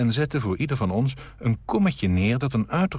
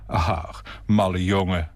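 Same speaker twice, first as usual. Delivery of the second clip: between joy and embarrassment; slower than usual. A narrator's reading. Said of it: "in een timbre tussen vreugde en schaamte".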